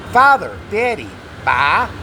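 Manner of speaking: ordinary talk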